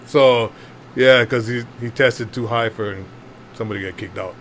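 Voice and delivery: ordinary talk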